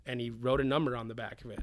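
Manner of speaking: usual speaking voice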